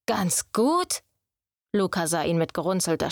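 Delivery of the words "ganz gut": ganz gut? asked with a sharp rising disbelief; incredulous echo. Acted. Luca throws Quinn's words back, incredulous. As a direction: toss the words back at him, doubting.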